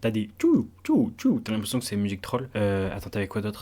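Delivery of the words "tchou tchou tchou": high hooted "tchou"; imitated sound effect. Reviewer imitates the troll-music beat, high hooting. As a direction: punch out high, loud little hoots.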